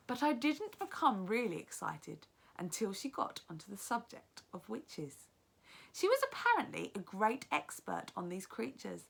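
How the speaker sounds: lively read-aloud narration, teacher to class; story narration. A narrator's reading. Teacher reads the novel aloud.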